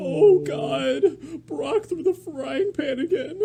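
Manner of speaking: high, slow squeaky cartoon voice. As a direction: play a panicked cartoon character.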